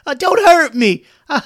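very high, loud panicked begging, fading at the end; yelled pleading. A narrator's reading. Bones surrendering to the approaching elephants, yelling in panic. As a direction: beg for mercy in a loud squeal, then tail off. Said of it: "held up his hands and yelled".

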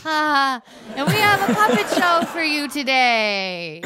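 high, slow sing-song announcement; puppet-show host. Acted. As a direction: stretch it out sweetly for the audience. Bird act announces puppet show.

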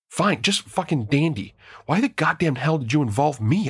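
high, raw snarl of disbelief. A narrator's reading. Narrator snarls at Kurt for dragging her in. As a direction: spit it out, furious and cornered. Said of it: "I snarled".

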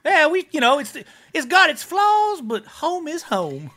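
high, animated character voice; machine-shop boss character. Acted. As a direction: defend the system in a lifted voice. Voices Amberiel, non-player character.

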